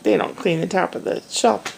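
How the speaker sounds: ordinary talk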